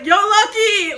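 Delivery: loud, high-pitched holler; calling someone back. Retold or imitated. Chef calling Lucky to come back, loud high holler. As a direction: call after a friend riding away. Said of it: "to get him to come back".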